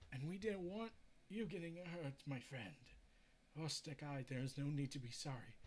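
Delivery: usual speaking voice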